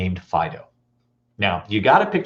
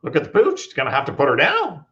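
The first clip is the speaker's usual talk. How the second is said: a hint of a clueless guy; lightly voiced dog owner; 5 semitones higher than usual.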